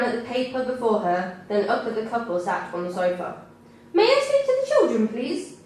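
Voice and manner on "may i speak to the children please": high, polite official asking; social worker voice. A narrator's reading. Social worker asking to see the children, high and polite. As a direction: lift the voice into a prim official. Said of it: "said the social worker (scene)".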